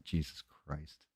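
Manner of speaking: slow, quiet heavy Southern drawl; Southern accent. Shown as a demonstration. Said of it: "In a heavy Southern accent".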